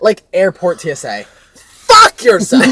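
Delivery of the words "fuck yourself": mock outburst. Mock rant at airport.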